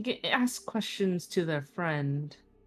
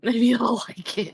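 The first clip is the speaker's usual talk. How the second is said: laughing; 3 semitones higher, 6 dB louder than usual. Real, not acted. Laughing at own harp joke.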